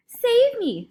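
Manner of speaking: high, loud cry for help; terrified scream. A narrator's reading. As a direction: scream for rescue. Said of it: "screamed the flesh lump eater".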